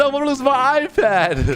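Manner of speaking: high, whiny little bully voice; whiny kid. Acted. Caught bully whining to the narrator about his iPad, high whiny voice.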